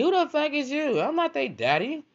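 very high, loud incredulous squawk; indignant outburst. Acted. Man asked to feed her kids, indignant high-pitched protest.

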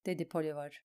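plain reading voice

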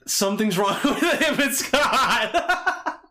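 breaking into laughter mid-line; laughing. Real, not acted. Laughing while reading the ad.

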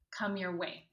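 ordinary talk, nothing marked

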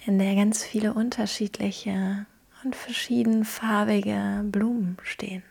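calm, gentle guiding through a visualisation; guided meditation. Acted.

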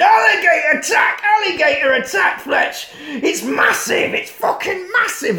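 high, loud yell of warning; frantic acted alarm; mock alarm shout. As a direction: scream the attack like a hammed-up action scene.

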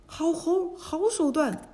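high, excited cheer; cheering praise. A narrator's reading. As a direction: cheer like delighted onlookers. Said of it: "鼓掌称道".

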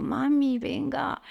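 put-on coaxing mum like one of her kids; children's pleading.

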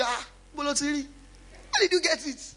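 mock amazed listener. Imitating impressed listeners.

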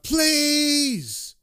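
long high-pitched mock yell; yelled plea. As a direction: wail the word out in acted agony. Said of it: "he yells out".

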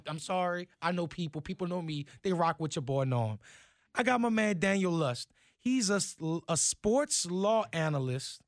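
ordinary talk

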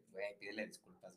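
hushed and low; quiet aside. Retold or imitated. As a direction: murmur it privately to a friend. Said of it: "yo le dije a este güey".

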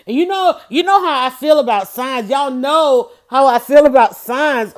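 loud, emphatic setup; building up to the big reveal; excited buildup. Real, not acted. Hyping up her viewers about the sign.

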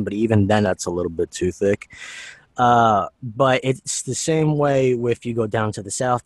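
ordinary talk

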